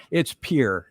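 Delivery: "Pierre" said as one syllable, "peer"; local pronunciation. Shown as a demonstration. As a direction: drop the ending so "Pierre" sounds like pier. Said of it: "just look at P I E R".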